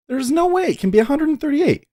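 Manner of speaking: high, strained outrage at the number; incredulous squeal of outrage; exasperated disbelief. Real, not acted. Exasperated rant over stock price.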